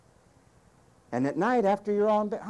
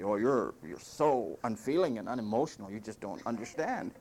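mock accusing complaint; 4 semitones lower than usual. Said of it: "accuse me of being stern".

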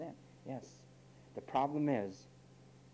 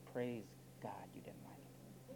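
whispered aside; 10 dB quieter than usual. Real, not acted. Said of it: "drops to a whisper aside".

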